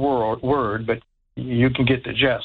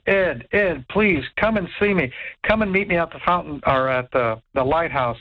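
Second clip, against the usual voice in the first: ordinary delivery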